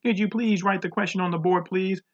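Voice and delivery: high, singsong mimicry of a pupil; mocking. A narrator's reading. Teacher echoing a pupil's request, mocking. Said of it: "in a mocking voice".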